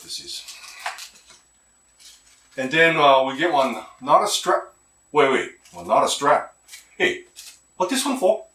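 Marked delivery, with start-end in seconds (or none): none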